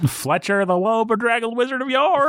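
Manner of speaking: high, theatrical wizard proclamation; hammed-up announcement. Host reads wizard donor title, high mock proclamation. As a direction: proclaim it high and grandly silly.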